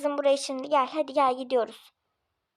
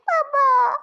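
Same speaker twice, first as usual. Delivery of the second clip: put-on a higher, louder child's cry for her father; child calling; 9 semitones higher, 5 dB louder than usual. Cold girl outside calls to her father, in a high childlike cry. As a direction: call out to daddy like a little girl.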